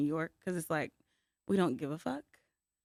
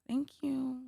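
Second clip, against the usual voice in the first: soft, high, half-hearted thanks; feigned gratitude; 7 semitones higher, 8 dB quieter than usual. Retold or imitated. Thanking someone for an unwanted sports gift, feigned gratitude. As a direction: imitate a polite but unconvinced thank-you.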